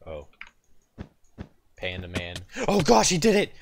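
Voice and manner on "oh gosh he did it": high, loud surprised cry; surprised shout. Real, not acted. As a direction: blurt it out in disbelief. Surprised gamer reacts loudly.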